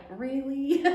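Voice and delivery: high incredulous "really". Retold or imitated. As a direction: pitch it up in happy disbelief. Retelling reaction to gift pillow.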